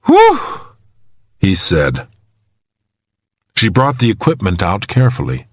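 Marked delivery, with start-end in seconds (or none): 0.1-0.4 high, loud whoop of relief; relieved whew. A narrator's reading. Trapped man hears the gear is found, relieved whew.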